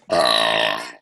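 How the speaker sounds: word pushed out as a burp. Real, not acted. Judge burps the score.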